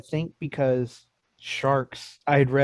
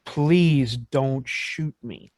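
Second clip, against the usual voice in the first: slow plea; much slower than usual. Retold or imitated. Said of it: "he goes".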